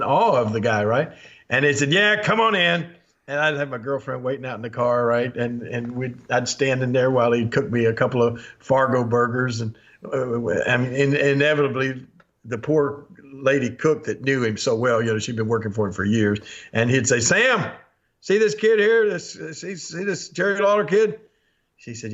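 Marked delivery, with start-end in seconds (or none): 1.9-2.9 imitating an offhand boss, pitched up; lightly voiced owner calling someone in; club owner's invitation. Club owner lets starstruck kid inside, calling out. 17.2-21.2 imitating a boss, voice pitched high; boss calling out. Boss teasingly points out kid to his cook, calling out.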